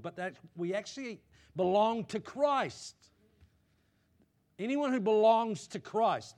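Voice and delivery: put-on a pastor raising his voice on the old life being gone; full-force preaching. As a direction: preach it out to the congregation, building loud.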